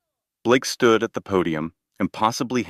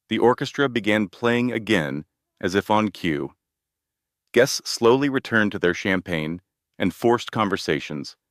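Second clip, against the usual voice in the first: plain reading voice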